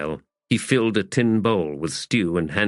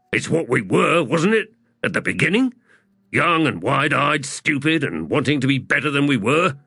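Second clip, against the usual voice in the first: higher, insistent appeal; heated argument; 7 semitones higher than usual. Acted. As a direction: argue back with urgency. Hedge argues back in quarrel.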